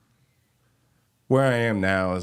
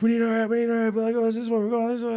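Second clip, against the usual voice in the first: high, frantic mock yell of the assistant; imitating a frantic production assistant yelling; faster than usual. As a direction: play the panicked set assistant barking orders, pitched way up. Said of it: "She's like".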